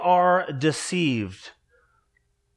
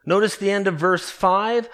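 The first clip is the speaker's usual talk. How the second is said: usual speaking voice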